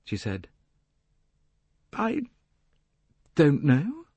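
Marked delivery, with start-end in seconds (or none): 1.9-4.1 high, halting admission; waver, pausing after the first word; uncertain. A narrator's reading. Jacqueline faltering under Poirot's warning, uncertain. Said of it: "to flicker uncertainly".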